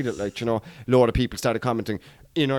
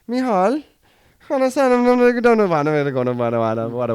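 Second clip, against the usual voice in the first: higher, louder mock classroom babble; mocking Irish class chatter; 5 semitones higher, 5 dB louder than usual. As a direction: imitate a chaotic Irish class, raised pitch.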